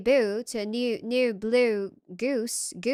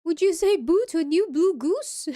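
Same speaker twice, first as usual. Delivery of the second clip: higher, playful copy of RP; imitated RP; 5 semitones higher, 4 dB louder than usual. Viewer echoing the presenter, in imitated RP. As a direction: mimic the RP line, pitched up. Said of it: "R P".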